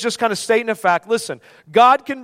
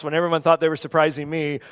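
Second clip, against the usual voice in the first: usual speaking voice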